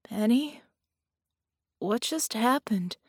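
quavering. A narrator's reading. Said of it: "his voice quavering".

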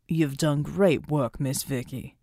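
put-on low, slow, warm older man's voice; warm praise. Veteran hero thanks Vicky, low and warm. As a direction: praise her gently, from deep down. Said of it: "Pride's voice was warm".

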